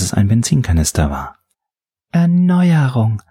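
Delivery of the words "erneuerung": high, drawn-out timid announcement; timid, submissive. A narrator's reading. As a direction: shyly intone it, pitched up and slow. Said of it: "verkündete der Unterwürfige schüchtern".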